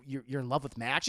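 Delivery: low, halting question as Bruce; acted low, stunned question; hurt disbelief. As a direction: ask it low and stumbling, rising at the end. Bruce voiced, asking in disbelief.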